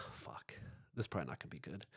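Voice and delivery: very soft, muttered dread. Retold or imitated. Gym worker bracing for the steam room, muttered dread. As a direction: mutter it under your breath. Dread after closing door.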